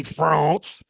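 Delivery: pompous mock French accent; exaggerated French accent. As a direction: hit it with an overdone French accent. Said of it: "they break into that accent".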